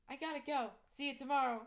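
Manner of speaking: loud, high farewell call. Acted. Teacher leaving for home, calling out. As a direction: call a hurried goodbye across the school gates. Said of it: "I called out".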